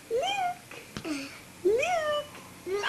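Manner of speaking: very high soft coo. Real, not acted. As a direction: sing his name softly. Cooing at baby.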